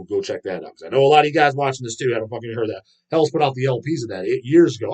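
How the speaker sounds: ordinary talk, nothing marked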